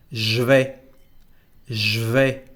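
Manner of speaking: "je vais" said zh-vay, no E; contracted je. Shown as a demonstration. Teacher showing the second contraction, "je vais" said as zh-vay. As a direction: buzz zh straight into "vais". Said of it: "we keep the sound je without the letter E".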